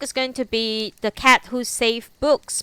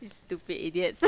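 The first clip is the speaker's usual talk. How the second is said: laughing mock insult; 4 semitones lower, 10 dB quieter than usual. Real, not acted. Laughing at teasing chat.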